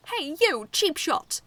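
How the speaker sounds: high, loud accusing yell. A narrator's reading. Medaby accusing Koji of a cheap shot, yelling. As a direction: call him out angrily. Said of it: "Medaby yelled".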